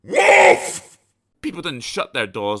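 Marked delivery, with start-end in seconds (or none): 0.0-1.0 a dog barking as loud as it can; huge shouted woof. Acted. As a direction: bellow the bark very high and loud. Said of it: "take a deep breath".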